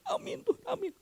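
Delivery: very high, weepy amin Tuhan; hammed-up tearful prayer; mock teary amen. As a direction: imitate a congregant crying amen. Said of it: "Sambil nangis-nangis".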